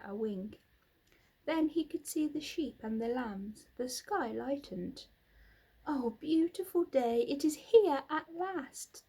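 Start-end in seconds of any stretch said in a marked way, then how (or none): 5.9-9.0 excited. A narrator's reading. Wilbur greets the dawn.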